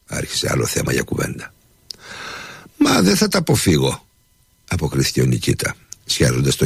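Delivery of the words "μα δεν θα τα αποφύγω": much higher, resigned servant voice; humble servant. A narrator's reading. Nikita replies. (ψηλή, παραιτημένη φωνή υπηρέτη)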